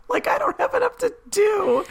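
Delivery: high sarcastic squeak of protest; mock complaint. Joking to co-host about having too many podcasts, high sarcastic whine. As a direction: pretend to be swamped, voice jumping way up.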